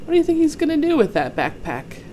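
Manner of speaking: plain reading voice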